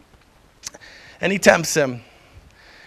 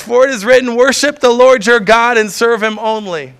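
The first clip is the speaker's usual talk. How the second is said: raised, forceful scripture quote; Jesus firmly rebuking Satan, raised voice; firm declaration; 4 semitones higher, 7 dB louder than usual. Acted. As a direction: proclaim it loudly with authority.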